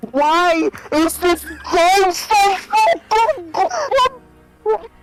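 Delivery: very slow, high, drawn-out cry; moaning with delight; stretched-out awe. Real, not acted. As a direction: drag every word in disbelief. Stretched amazed exclamation.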